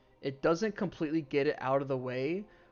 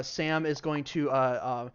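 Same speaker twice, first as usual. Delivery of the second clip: ordinary talk, nothing marked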